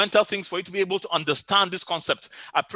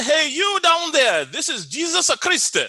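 put-on slow, high Italian-accented call, louder at the start; 9 semitones higher, 3 dB louder, slower than usual. Italian workman repeating his prank on the praying woman, high mock-divine Italian accent. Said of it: "then in his Italian voice".

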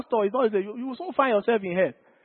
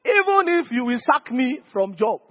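ordinary talk, nothing marked

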